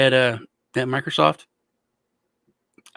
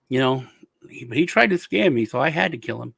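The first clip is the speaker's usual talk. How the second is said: ordinary talk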